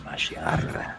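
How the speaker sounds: low, hushed, drawn-out "Mashiara". Shown as a demonstration. Co-host asked to repeat "Mashiara", slow and hushed. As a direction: draw "Mashiara" out softly in a deep breathy voice. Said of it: "Can you say that just a little bit slower?".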